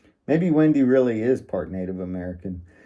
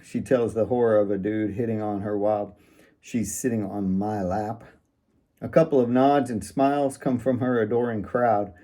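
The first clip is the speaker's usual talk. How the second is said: plain reading voice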